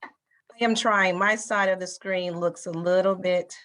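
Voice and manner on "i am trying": usual speaking voice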